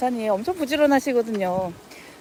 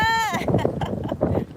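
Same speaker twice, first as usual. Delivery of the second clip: laughing; 10 semitones higher, 3 dB quieter than usual. Real, not acted. Laughs after calling out.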